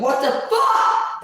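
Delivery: high, slow, drawn-out disbelief; shocked what the fuck. Retold or imitated. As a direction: stretch it out, outraged and baffled. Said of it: "So you're like".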